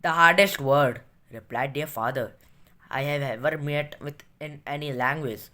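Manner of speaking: slow, low-pitched read-aloud; story reading. A narrator's reading. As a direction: read it out deliberately, word by word. Reads story aloud in English.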